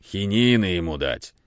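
understated, awkward suggestion; embarrassed. A narrator's reading. Uncle advising on the sick boy, embarrassed. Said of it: "сказал смущённо Иван Иваныч".

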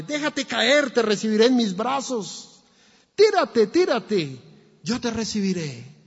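high, loud urgent call to jump; father shouting up. Acted. Father below the burning house shouts up to his son, loud and urgent. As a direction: shout up to the window to let go. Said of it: "gritaba el padre".

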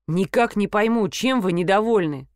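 usual speaking voice

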